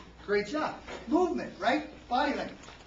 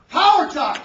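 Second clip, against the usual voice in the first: booming, very loud slogan; forceful power pitch; 4 semitones higher, 14 dB louder, slower than usual. Shown as a demonstration. Speaker demonstrating body language to the workshop, booming. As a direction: belt out POWER TALK like a stage speaker. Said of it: "how about this body language".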